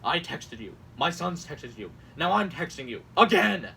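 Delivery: mock indignation building to a loud "Again!"; overdramatic. Host acts out a campaign text, overdramatic. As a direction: punch each complaint, then explode. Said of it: "it's so overdramatic, too".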